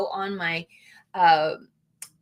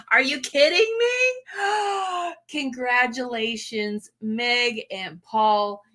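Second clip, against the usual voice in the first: teary surprise; slower than usual. Real, not acted. Vlogger hearing of fans' wedding, tearful and overjoyed. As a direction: choke up over the newlyweds' news. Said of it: "I bawl".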